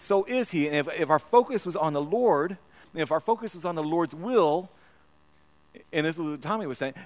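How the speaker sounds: ordinary talk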